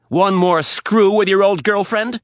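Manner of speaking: high, loud, slow accusation; furious jab. Acted. Riley jabbing Rapp about his old girlfriend, very loud. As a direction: spit it out.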